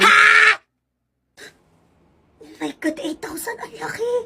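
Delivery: high shocked cry, dropping to a breathy wail; shocked, then a wailing cry; shocked gasp. Acted. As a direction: reel at the price. Mother shocked at eight thousand.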